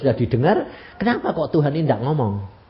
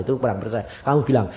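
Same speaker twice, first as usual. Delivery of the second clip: ordinary talk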